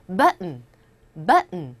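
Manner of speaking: BUTTON with a stopped, unheard T, falling; native swallowed T. Shown as a demonstration. Teacher models natives for learners, BUTTON with a swallowed T. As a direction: catch the T in BUTTON and release only an N. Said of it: "왜 발음을 저렇게 할까".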